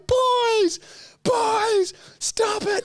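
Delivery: high, loud, crying mother's wail; mum yelling. Retold or imitated. Mum breaking up the boys' fight, high and loud. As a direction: shriek it like a frantic mum. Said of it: "She's going".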